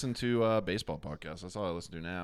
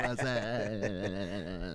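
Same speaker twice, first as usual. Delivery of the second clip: long stretched "whassup"; silly catchphrase answer, held long; drawn-out catchphrase. Acted. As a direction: drag the vowel out for seconds, goofy. Trading the catchphrase back and forth.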